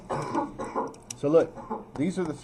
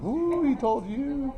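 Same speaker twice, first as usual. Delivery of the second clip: much higher, slow drawn-out ooh, teasing; mocking students; 12 semitones higher, much slower than usual. Teacher mimics students teasing Eric, with a slow, much higher drawn-out ooh. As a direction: mimic the class egging on a fight. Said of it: "they're getting all like".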